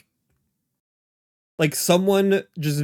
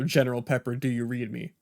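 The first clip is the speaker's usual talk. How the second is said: calm and measured; 5 semitones lower, 7 dB quieter, faster than usual. Shown as a demonstration. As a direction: say it calmly after a pause. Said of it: "you say their name first, give it a sec, and then say".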